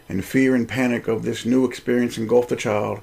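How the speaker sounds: ordinary delivery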